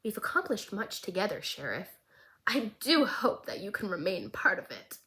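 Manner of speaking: soft, menacing near-whisper; menacing threat. Acted. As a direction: threaten him quietly, almost breathing the words. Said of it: "very threateningly and very menacingly".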